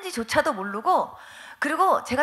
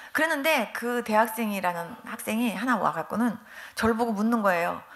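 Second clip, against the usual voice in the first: ordinary delivery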